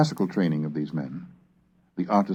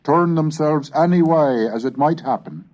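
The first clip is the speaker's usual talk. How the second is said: put-on raised, measured oratorical reading of old prose; formal reading; 9 semitones higher, 6 dB louder than usual. Lecturer reading Hooker's prose to the class, in an oratorical voice. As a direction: declaim it like a Tudor sermon.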